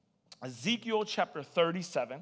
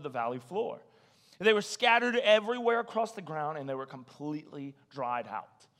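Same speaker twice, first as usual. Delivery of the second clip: scripture reading; 3 semitones lower, 4 dB quieter than usual. Acted. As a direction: read the verses low and measured.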